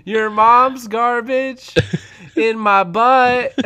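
put-on high, loud, drawn-out kid comeback; little kid. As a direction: imitate a little kid yelling back slowly. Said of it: "And the little kid goes".

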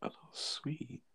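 whispered disbelief; whispering. Real, not acted. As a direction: breathe it out in a whisper. Incredulous aside about a target.

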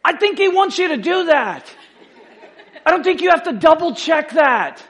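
high, loud, incredulous comeback; emphatic retort. Retold or imitated. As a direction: hit every word as if it's blindingly obvious. Mock exasperated reply to a doubter.

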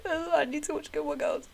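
high, put-on pitiful whine; mock sad plea. Talking partner into a comfort show, mock sad. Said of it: "Only when I'm sad".

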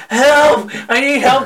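mock distressed yell; retold screaming. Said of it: "the character's on the ground screaming".

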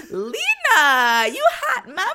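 high, loud laughing shout; hype shout. Retold or imitated. Friends cheering Lena's hot outfit, laughing shout. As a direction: cheer her on like a hype girl.

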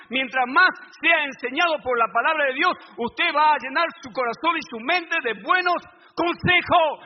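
loud, driving preacher's cadence; fiery preaching. Acted. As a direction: preach it to the congregation with fire. Preacher at full force.

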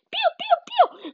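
very high squeaky cheeps; cheeping chick. A narrator's reading. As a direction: cheep frantically on the run. Chick cheeping as they run. (pigolio acutissimo)

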